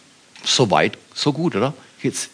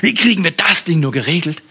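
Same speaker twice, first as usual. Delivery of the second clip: high, loud fretful exclaim; mock panic about circumstances; hammed-up worry; worried sigh; 7 semitones higher, 3 dB louder than usual. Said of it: "ich schaue auf Umstände und denke".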